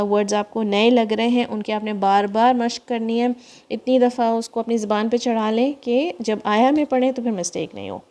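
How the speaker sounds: ordinary delivery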